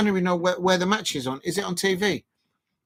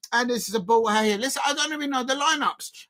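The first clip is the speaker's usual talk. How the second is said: ordinary talk, nothing marked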